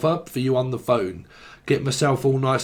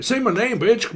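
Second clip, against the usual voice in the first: higher-pitched cheeky demand; playful pitched-up command; mock demand; 8 semitones higher than usual. Mock demand after muttered quote.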